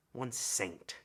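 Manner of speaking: ordinary talk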